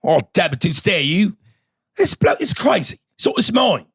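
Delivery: high, light, exaggerated English accent; mock British accent. Guest answers threat of a British beating, mock English accent. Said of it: "it sounds too light".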